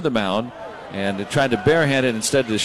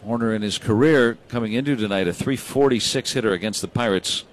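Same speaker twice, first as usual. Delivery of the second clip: ordinary talk, nothing marked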